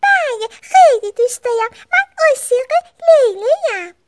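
high, loud, thrilled reply; Suzy thrilled to be invited to play; delighted. A narrator's reading. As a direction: gush with happy excitement. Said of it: "با لبخند گفت".

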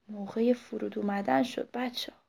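usual speaking voice

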